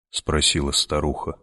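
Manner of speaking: ordinary delivery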